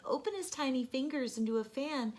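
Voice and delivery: ordinary delivery, nothing marked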